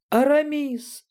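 very high delighted shriek; high, happy girlish squeal; joyful squeal. A narrator's reading. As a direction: squeal the name with joy. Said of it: "радостно взвизгнула Галя".

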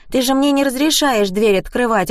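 put-on little girl Anya. A narrator's reading. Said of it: "ответила Аня".

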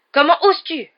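high, outraged yell. A narrator's reading. Alicia outraged at Malfoy's insult, yelling. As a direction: shout it in shock and anger. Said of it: "hurla Alicia".

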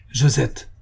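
mild warning exclamation; exclaiming. A narrator's reading. Count warning his defiant wife, exclaiming. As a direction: call her name in understated warning. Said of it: "s'écria monsieur Moret".

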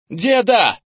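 loud, high-pitched shout; boy's cry. A narrator's reading. Kenny running to his grandfather, crying out. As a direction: cry out across the yard. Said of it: "раздался крик Кенни".

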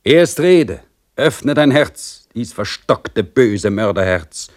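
stern priest demanding a confession; admonishing priest. Acted. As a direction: press him to confess with pious severity. Priest withholds water.

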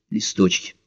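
ordinary delivery, nothing marked

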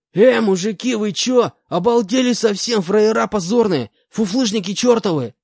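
very high, loud panicked convict yell; yelling at the top of his lungs. Acted. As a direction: scream curses in a panic. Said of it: "начал орать во всю глотку".

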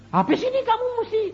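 high, loud and rapid outburst; acted-out high-pitched yell; retold shouted threat.

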